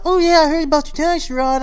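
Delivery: high, quick mocking classmate impression; classmates' voice. Classmates praising him after the announcements, high and quick. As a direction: play an impressed classmate in the hallway. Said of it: "y'all be like".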